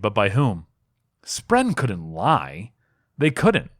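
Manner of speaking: usual speaking voice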